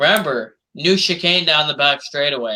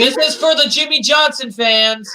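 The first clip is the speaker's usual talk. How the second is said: mock taunt; 9 semitones higher than usual. Mock taunt, high far voice.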